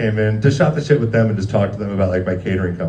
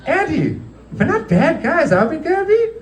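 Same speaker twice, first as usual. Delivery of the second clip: high, hammed-up wheedling voice; mock innocent owners; 3 dB louder than usual. Restaurant owners pictured as guilty villains, mock innocent. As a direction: plead innocence a little too sweetly. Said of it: "kinda like, like Nazis".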